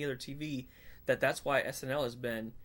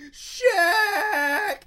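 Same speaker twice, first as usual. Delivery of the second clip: much higher, louder cry of Shaq; excited shout; 11 dB louder than usual. Retold or imitated. Fan greeting Shaq in the movie, excited shout. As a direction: yell the name with glee. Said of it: "he's like, he's like".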